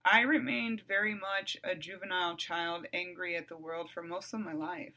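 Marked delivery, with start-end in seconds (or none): none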